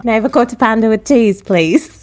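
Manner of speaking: playful quick Cockney order; Cockney accent. Pretend. Host playing a customer ordering food, Cockney accent. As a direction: slip into a Cockney accent, briskly. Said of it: "There goes her accent".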